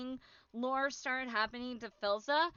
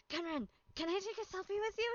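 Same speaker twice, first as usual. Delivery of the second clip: soft, quick timid ask; shy request; 7 semitones higher, 6 dB quieter, faster than usual. Retold or imitated. Fan asking Gunrun for a selfie, soft and quick.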